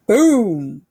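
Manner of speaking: big loud BOOM like thunder. A narrator's reading. Narrator voicing thunder at the party, loud boom. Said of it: "went the thunder".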